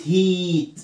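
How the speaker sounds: "هيت" with a drawn-out ee, like heat; long ee. Shown as a demonstration. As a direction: stretch the vowel of "هيت" slowly. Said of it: "زي كلمة إيه؟".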